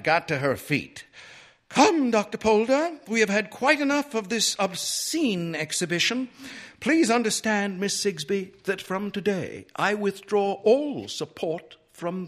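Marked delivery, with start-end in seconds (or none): 1.7-12.3 high, imperious indignation; haughty lady, loud start, pitched way up; outraged matron. A narrator's reading. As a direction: announce a grand withdrawal in a high, offended voice. Outraged Missus Dillway storms out.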